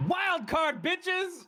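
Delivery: high gleeful whoop; excited yell. Real, not acted. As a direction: shout it out with wild glee. Player's exultant high yell.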